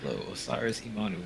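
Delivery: ordinary talk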